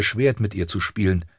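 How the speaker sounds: ordinary delivery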